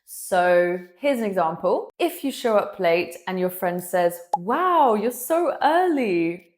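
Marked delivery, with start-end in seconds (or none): 4.4-6.5 mock-amazed, high and drawn-out; sarcastic wow, pitched up and stretched; sarcastic and silly. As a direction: tease a latecomer with exaggerated, slow sarcasm. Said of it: "in a sarcastic and silly voice".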